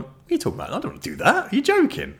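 high, incredulous scoff at the idea; mock scandalised younger self. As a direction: scoff like your old narrow-minded self. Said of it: "I think I'd have gone".

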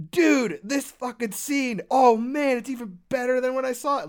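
excited. Retold or imitated. Retold reaction rewatching film.

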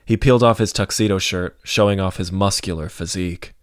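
plain reading voice

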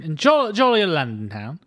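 mock Cockney tourist English accent; bad British accent. Shown as a demonstration. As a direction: ham up a cheesy English accent. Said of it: "the quality of the accent you just heard coming from me".

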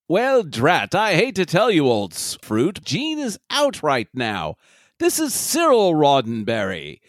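silly mock British gent; hammed-up British cousin; British accent. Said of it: "some British douchebag".

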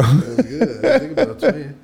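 high, loud laughing words. Real, not acted. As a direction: crack up while saying it. Laughing at twin bed idea.

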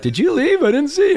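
high, loud mock voice of a confused guest; puzzled partygoer. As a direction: squeal it like a guest who lost track of you. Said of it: "And they're like".

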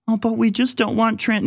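high, whiny parody of an opponent; whiny strawman voice; mocking whine. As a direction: sneer at their objection in a silly voice.

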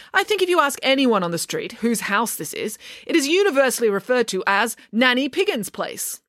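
a hint of pompous certainty; lightly voiced haughty nanny. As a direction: argue with mild hauteur. Figure argues in story.

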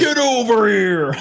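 high, slow, laughing battle cry; Mortal Kombat shout. Retold or imitated. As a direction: belt it like a fighting game character.

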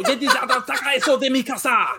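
laughing, high-pitched mock Belgian accent on Spanish words; weird Belgian accent. Podcaster replaying the keeper's save, laughing Belgian accent. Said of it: "in a weird Belgian accent".

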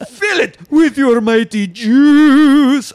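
slow, loud, grand proclamation; dramatic booming voice. Pretend. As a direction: boom it out slowly. Mock dramatic Jesus line.

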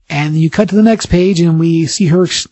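ordinary delivery

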